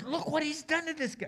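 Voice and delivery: high-pitched mock alarm; shocked townspeople. Townspeople seeing the healed man, shocked outcry. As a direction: voice leaps up in disbelief.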